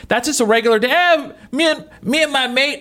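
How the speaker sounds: a weak, high attempt at an Australian accent; bad Australian accent. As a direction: try an Australian accent and fail. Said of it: "I can't do an Australian accent".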